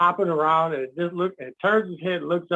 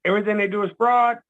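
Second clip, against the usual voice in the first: ordinary talk, nothing marked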